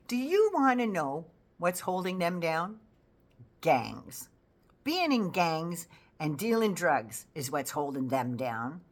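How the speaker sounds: ordinary delivery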